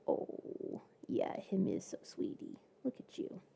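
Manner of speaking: soft whispered pet talk. Real, not acted. Whispering baby talk to her Velcro kitty.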